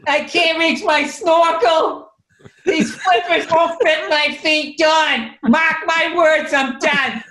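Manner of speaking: high, slow whining with a laugh; mock whiny Bob. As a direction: whine like a defeated big guy on the beach. Mocks friend whining on beach.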